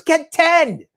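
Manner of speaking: very high, eager exclamation; mock excitement. Guy ordering ten cheap helicopters, giddy excitement. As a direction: squeal the order like a giddy buyer. Acted buyer jumps at cheap price.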